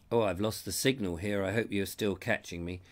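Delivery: ordinary talk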